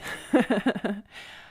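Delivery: light, high-pitched run of chuckles; self-conscious laugh. Real, not acted. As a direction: laugh at your own rambling. Laughs at how long episode is.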